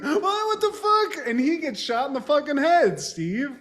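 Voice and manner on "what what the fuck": very high, drawn-out mock panic; mock shocked cry. Retelling the other guy seeing his partner slashed, high mock shock. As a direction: squeal it like a stunned bystander.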